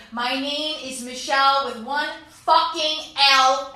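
mock-fierce showdown line; firm furious declaration. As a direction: plant your feet and lay it down. Climactic confrontation.

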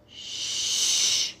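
steady unvoiced hush; hushing shush. A narrator's reading. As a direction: shush them insistently. Bulldozer shushing the trucks.

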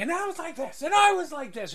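high excited bragging; high pitched showing off mid sword fight; swaggering boast. Acted. Maid on a table brags high while sword fighting pirates. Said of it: "She's on the table with a sword out and going".

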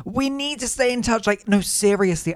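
high, gushing parting promise; acted insistent promise.

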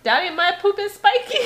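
put-on high little-kid voice; high-pitched toddler impression; [name]. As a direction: imitate a small child calling daddy. Voices small child telling daddy.

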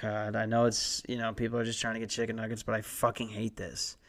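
imitated weary attitude. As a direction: imitate a fed-up young worker, keeping it quiet. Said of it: "it's almost the same attitude as me".

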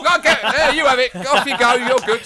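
high, loud panicked footballer; tensed-up panic. Acted. Nervous player receiving the ball, high panicked shouting. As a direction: shriek in mock panic to get rid of it. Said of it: "I, I tense up".

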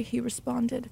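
usual speaking voice

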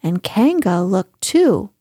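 usual speaking voice

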